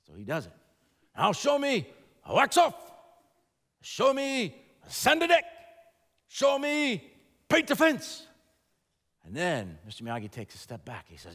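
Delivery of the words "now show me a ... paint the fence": drilling commands. Retold or imitated. Miyagi drilling Daniel.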